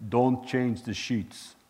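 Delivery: slow, deliberate impression of an old-time preacher; put-on slow, weighty command; Smith Wigglesworth. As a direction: draw out each word in imitation. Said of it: "That's how he talked".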